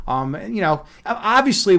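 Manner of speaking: normal talking voice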